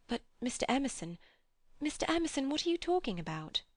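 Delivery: put-on rising high and quick, a frightened young woman; Lucy pressing for an answer, voice climbing in alarm; alarmed plea. A narrator's reading. Lucy begs old Mister Emerson to explain, alarmed and high.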